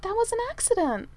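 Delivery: high, feeble excuse; weakly. A narrator's reading. Manservant excusing the melted hauberk, weakly. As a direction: protest without much conviction. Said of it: "said Merlin weakly".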